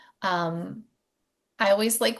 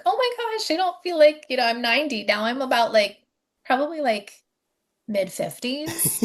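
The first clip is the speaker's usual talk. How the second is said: high, delighted burst of surprise; excited morning exclaim; 6 semitones higher, 4 dB louder than usual. Retold or imitated. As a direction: gush it like a happy discovery. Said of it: "I wake up, I'm like".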